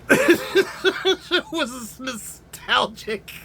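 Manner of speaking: slow words pushed through high giggles; laughing. Real, not acted. Reviewer feeling nostalgic about the film, laughing. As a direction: crack up while saying it.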